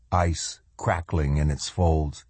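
ordinary delivery

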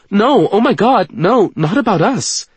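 high, loud, urgent protest; panicked denial. A narrator's reading. Woman realizing he fears for their relationship, panicked. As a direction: rush to correct the misunderstanding. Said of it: "she says quickly".

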